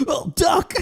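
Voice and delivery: high mock shout of panic; mock panicked yell. Cops under fire yelling to duck, hammed-up panic. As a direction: yell it like a cop ducking bullets. Cops voiced in retold shootout.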